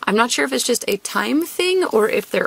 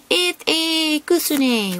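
odd put-on voice; 4 semitones higher, much slower than usual. Real, not acted. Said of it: "Why am I talking like this?".

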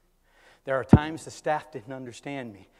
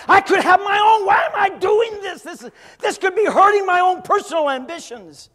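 high, loud, fed-up outburst; frustrated shout; 15 dB louder than usual. Retold or imitated. The volunteer complaining to himself, high and shouting. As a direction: shout the old resentment out. Retells his own frustrated thoughts.

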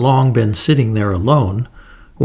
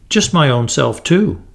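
ordinary delivery

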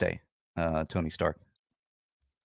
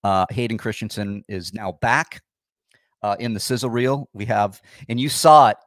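ordinary talk

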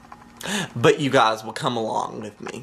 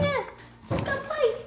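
high squeaky voice. Acted. High voice hunting fly.

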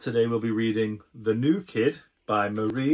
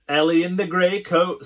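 teasing; 7 semitones higher, 4 dB louder, faster than usual. Acted. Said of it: "someone says".

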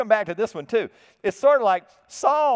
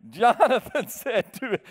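slow, higher, breaking up with real laughter; laughing while reading; 4 semitones higher, slower than usual. Real, not acted. Preacher restarting the Bible verse for his congregation, laughing through it. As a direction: try to read on but crack up.

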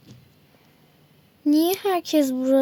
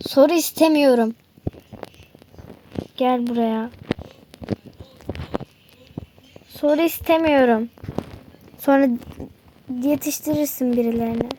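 lower, menacing kidnapper; a low, menacing villain catching his victim; 5 semitones lower, faster than usual. Acted. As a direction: growl it like a gloating villain. Villain refuses questions.

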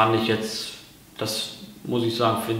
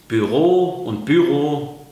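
Büro and Büro, slow and louder, first bü-RO then BÜ-ro; contrasting stress; 4 semitones higher, 5 dB louder, slower than usual. Shown as a demonstration. The teacher contrasts German and Swiss Büro, slow and louder. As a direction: slowly set the two stresses of Büro side by side. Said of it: "das ist schon ein Unterschied".

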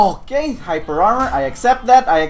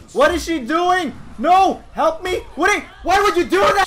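high-pitched frantic pleading; alarmed protest; 10 semitones higher than usual. Real, not acted. Gamer abandoned by teammate, panicked protest. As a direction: cry out for help in dismay. Teammate leaves him to die.

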